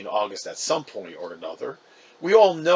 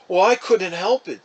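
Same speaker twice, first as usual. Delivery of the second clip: whining; 7 semitones higher, 7 dB louder than usual. Acted. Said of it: "kind of whining".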